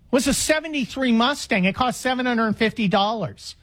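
ordinary delivery

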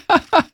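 high laugh. Real, not acted. Laughing at hiccup banter.

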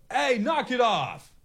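high, slow shout to stop a stranger; stretched-out warning yell at a man across the road; yelling across the street. Retold or imitated. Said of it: "Yelled across Twenty-fourth Street".

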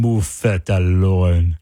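slow, sultry perfume commercial voice; sexy perfume ad. Acted. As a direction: purr it like a perfume ad. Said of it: "tell me that doesn't sound like a sexy perfume".

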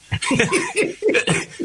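high burst of laughter. Real, not acted. Telling Bobby the Dave Garcia story, bursting out laughing.

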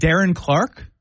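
"Darren Clark" rising at the end like a question. Real, not acted. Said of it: "You said that with a question mark".

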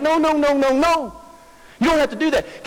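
high, rapid-fire refusal; exasperated Paul objecting. Acted. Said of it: "So Paul's like".

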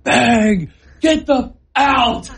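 high-pitched yell with drawn-out words; retold scream. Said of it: "I'm just screaming".

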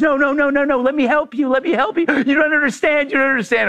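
high, frantic mock plea; pleading in a high voice to make them stay; pleading neighbor. Neighbor begging the family not to drive off, frantic.

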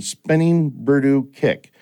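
put-on slow heavy Japanese accent; drawn out in a Japanese accent. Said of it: "heavy Japanese accent".

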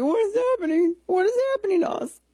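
squeaky high, loud mock panic; panicking markets. Host mocks freaking-out markets with a squealing panicked voice. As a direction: play the stock markets as a shrieking scared creature. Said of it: "They're like".

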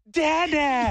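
high-pitched toddler imitation; little snitch. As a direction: tattle like a small child. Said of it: "This little snitch immediately goes".

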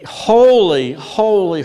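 high, loud cry, easing on the repeat; intensified proclamation. Acted. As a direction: ring out the word with a falling end. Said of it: "because that so intensifies".

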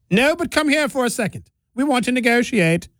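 high, plummy royal voice; a Mayan luring the French to talks, posh; mock British royalty. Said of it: "making the Incas and the Mayas sound like British royalty".

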